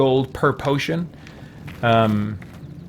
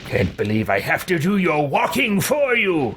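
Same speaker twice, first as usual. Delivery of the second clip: lordly exasperation that builds; haughty grumbling; 4 semitones higher than usual. Acted. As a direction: complain about useless underlings, pitch rising steadily. Haughty character scolds his party.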